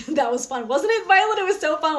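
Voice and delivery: high and loud, thrilled after dancing; excited. Real, not acted. To Violet, excited after rocking out together. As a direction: gush about the fun you just had.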